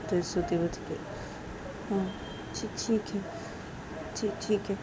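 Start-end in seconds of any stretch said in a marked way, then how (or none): 1.9-4.8 small soft stammered okay; timid, hushed agreement; meek reply. Acted. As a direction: answer quietly and hesitantly. Soti answers after crying.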